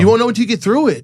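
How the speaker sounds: high, fast emphatic repeat; excited echo. Real, not acted. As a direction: jump in high and quick to agree. Excited echo of Jeff's point.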